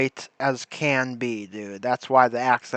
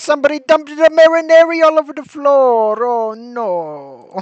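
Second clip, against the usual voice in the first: much higher, louder comic Italian cry; mock Italian accent; 10 semitones higher, 6 dB louder than usual. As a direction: squeal it like a cartoon Italian cook. Spoofing Italian sweeper character.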